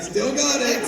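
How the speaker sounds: high-pitched imitated cheer; delighted approval. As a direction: call it out high, as a thrilled onlooker. Everyone's delighted reaction retold.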